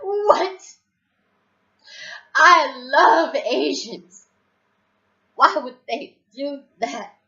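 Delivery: high, loud, drawn-out wail of disbelief; hammed-up slow outburst, way over the top; loud and extra. Stoned and shocked at the bomb news, loud and extra. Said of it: "I'm being so loud and so extra".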